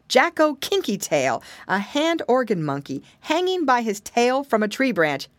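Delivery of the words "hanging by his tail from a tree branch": usual speaking voice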